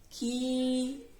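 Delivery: खी held long and level; drawn-out, steady खी; long ई sound. Shown as a demonstration. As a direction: stretch the ee in खी. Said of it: "ऐसे करना है".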